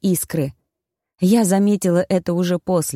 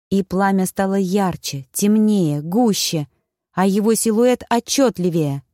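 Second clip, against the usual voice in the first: ordinary delivery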